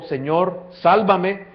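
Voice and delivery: retold cry. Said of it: "él gritó".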